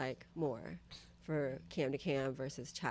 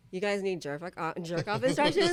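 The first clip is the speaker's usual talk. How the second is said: rising disbelief, louder and higher; incredulous; 4 semitones higher, 7 dB louder than usual. Retold or imitated. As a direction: ask it in shocked disbelief. Incredulous reply to clients.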